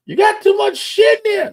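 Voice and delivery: very high, loud mock complaint; high-pitched comic holler; shouting computer. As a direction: yell it as an overloaded computer scolding its owner. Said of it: "I know my computer's like".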